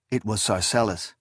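ordinary delivery, nothing marked

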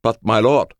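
high, loud servant's protest; protesting guard. A narrator's reading. Samurai guard objects to his master, high protest. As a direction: object urgently to your master.

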